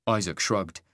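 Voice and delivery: ordinary delivery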